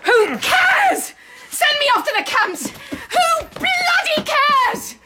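very high, screamed defiance; hysterical outburst. Acted. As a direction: scream recklessly at everyone present. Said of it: "Calm down, calm down".

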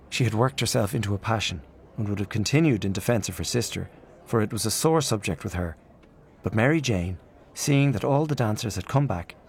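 ordinary delivery

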